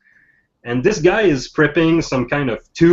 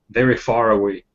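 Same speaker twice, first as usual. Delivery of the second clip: normal talking voice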